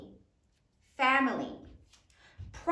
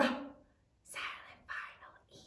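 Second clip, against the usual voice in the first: whispered, since the E is silent; 12 dB quieter, slower than usual. Shown as a demonstration. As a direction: whisper it like a secret letter. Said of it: "teacher whispers the silent letter".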